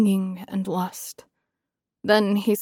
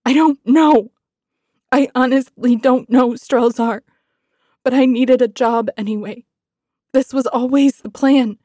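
louder, higher, anguished outburst; ragged and tearful; 4 semitones higher, 7 dB louder than usual. A narrator's reading. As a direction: burst out through hot tears. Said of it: "ragged laugh burst from my throat".

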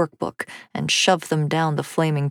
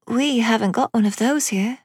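put-on high child's voice; wondering little girl; 6 semitones higher than usual. A narrator's reading. Amelia to her father about the figurine, wondering.

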